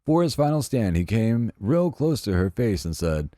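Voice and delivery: ordinary delivery, nothing marked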